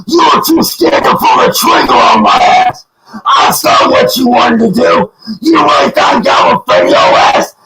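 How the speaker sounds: sobbing, out-of-breath screaming; breathless rage. Real, not acted. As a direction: yell so hard the breath runs out. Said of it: "Breathe, breathe".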